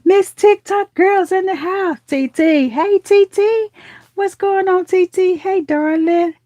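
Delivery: high, drawn-out singsong welcome; gasp, then call out a slow, high-pitched hello; excited greeting. Real, not acted. Calls out greeting to arriving viewer.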